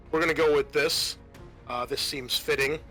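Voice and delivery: usual speaking voice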